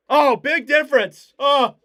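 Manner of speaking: sudden loud high "oh"; jolted by the replay; startled shout. Real, not acted. Reacting to replay.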